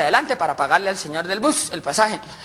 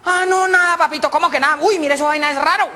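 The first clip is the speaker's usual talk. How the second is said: high character voice; 8 semitones higher than usual. Acted.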